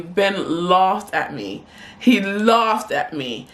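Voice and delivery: slow, heavy weight on "laughed"; "laughed" stretched long and stressed. Real, not acted. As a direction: drag out "laughed" slowly for emphasis. Emphatic repetition in storytelling.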